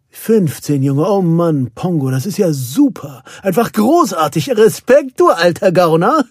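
excited praise building to loud cheer; admiring. A narrator's reading. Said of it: "sah mir bewundernd in die Augen". (bewundernd, immer begeisterter)

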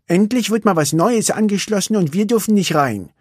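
sulky little racer; Vanellope. A narrator's reading.

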